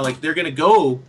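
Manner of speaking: usual speaking voice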